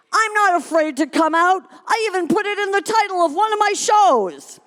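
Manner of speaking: high, loud and defiant; aggressive shout. Acted. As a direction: fire it back from her seat. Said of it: "shouted aggressively from her seat".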